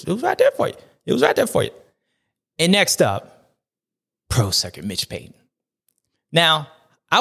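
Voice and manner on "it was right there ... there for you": pained high cry. Real, not acted. High-pitched emphatic lament.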